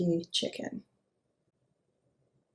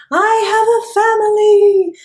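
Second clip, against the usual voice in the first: very high, loud squeal; mock-scared worm; 12 semitones higher, 12 dB louder than usual. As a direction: shriek like a cartoon victim. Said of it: "This is exactly what happened".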